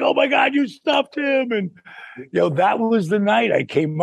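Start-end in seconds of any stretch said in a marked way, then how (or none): 0.0-1.5 high-pitched imitated amazement; Big Show. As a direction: exclaim it high, like an awed onlooker. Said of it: "and Big Show was like".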